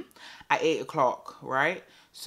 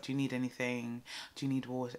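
soft, much quieter caring questions; calm gentle partner; 3 semitones lower, 10 dB quieter than usual. Retold or imitated. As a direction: ask tenderly, hushed.

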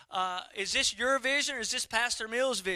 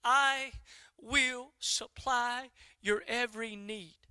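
slow, deliberate divine pronouncement; solemn, unhurried reply from above; God's answer; much slower than usual. Retold or imitated. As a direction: stretch the promise out word by word. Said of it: "And he said again".

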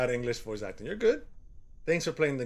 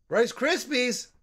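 drawn-out delighted cry; thrilled shout of recognition; excited; 11 semitones higher, 4 dB louder, much slower than usual. Real, not acted. Spots cereal in game.

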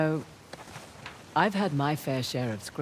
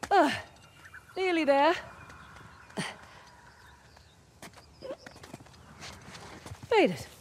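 climbing strain. Acted. Climber hauls herself up the last ledge, straining. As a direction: grunt it out near the top.

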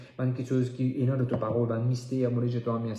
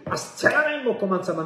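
high-pitched, forceful outburst; commanding prayer shout; 9 semitones higher than usual. Real, not acted. Preacher commanding ungodly voices, shouting. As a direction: cry out with sudden authority.

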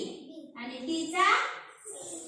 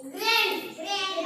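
drawn-out teacher demo; very slow model word; 3 semitones higher, much slower than usual. Shown as a demonstration. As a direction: stretch grand out slowly. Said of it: "हा, जी R A". (Grand खूप सावकाश)

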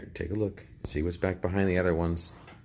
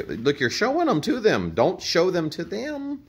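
scolding; 10 semitones higher, 6 dB louder than usual. Real, not acted. Parent scolding kids over cards.

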